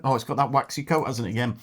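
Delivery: usual speaking voice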